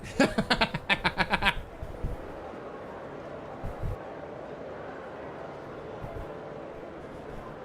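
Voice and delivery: soft run of quick chuckles; amused chuckle. Real, not acted. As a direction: laugh quietly at your own joke. Laughs at own park owner joke.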